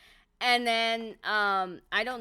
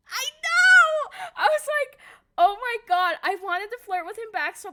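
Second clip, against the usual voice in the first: much higher, louder flustered outburst; flustered excitement; 11 semitones higher, 10 dB louder than usual. Real, not acted. Telling viewers about Sanji's flirting, flustered loud squeal. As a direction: squeal out your embarrassment.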